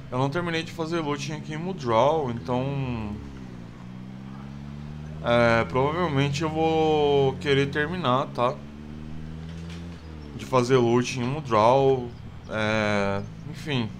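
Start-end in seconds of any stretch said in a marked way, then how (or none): none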